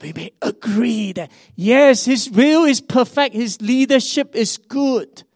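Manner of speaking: high, loud proclaiming; preaching at full force. Acted. Pastor proclaiming to the congregation, loud preaching voice. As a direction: lift it up and declare it to the church.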